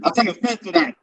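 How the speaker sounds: muffled, boxed-in bad mic sound; like talking from inside a cardboard box. Real, not acted. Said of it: "Sounds like you're talking from inside a box".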